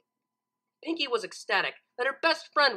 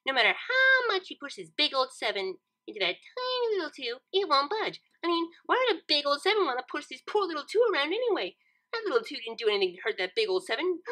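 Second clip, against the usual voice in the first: higher, fussy pony voice; Pinkie voice; 6 semitones higher than usual. A narrator's reading. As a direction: plead for the little two. Pinkie explaining numbers emotionally.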